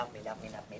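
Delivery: a faint actor's plea; mock needy actor, almost gone. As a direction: trail off begging love me. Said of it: "that's another actor's thing".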